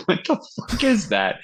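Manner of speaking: retold astonished reaction to the riff; incredulous. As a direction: pitch it way up in disbelief. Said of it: "I'm like".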